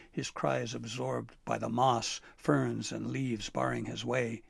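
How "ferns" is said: plain reading voice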